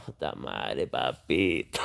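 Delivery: tired sigh of a dad woken up; weary muttered papito. Real, not acted. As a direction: mutter it to yourself, exhausted. Dad groans answering the call.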